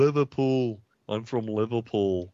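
put-on Scouse accent; Liverpool accent. Shown as a demonstration. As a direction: say it like a Liverpool local. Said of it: "even being from Manchester, it sounds different".

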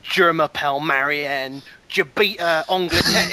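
French with heavy English vowels; British-accented French. Retold or imitated. Imitating mother's French, British accent. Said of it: "sounds like some British woman doing, you know, speaking French".

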